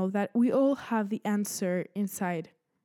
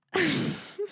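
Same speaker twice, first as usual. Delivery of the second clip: nervous giggle; 9 semitones higher than usual. Real, not acted.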